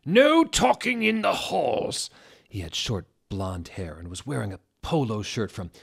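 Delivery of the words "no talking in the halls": high, loud, slow furious headmaster yell; angry yell. A narrator's reading. Headmaster yelling at goths in the hall. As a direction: bellow it down the hall. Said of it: "started shredding at us angrily".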